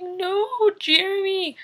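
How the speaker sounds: high, slow, crying plea; tearful cry. Retold or imitated. Vlogger replaying her tearful reaction to Jeremy, high slow crying plea. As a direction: stretch it out as if about to sob. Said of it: "I was in tears".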